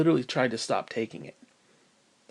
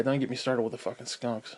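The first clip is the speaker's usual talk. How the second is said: ordinary talk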